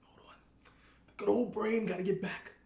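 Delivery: put-on creaky old-timer reminiscing, trailing off; very old man. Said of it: "it's Yogi Boomer old".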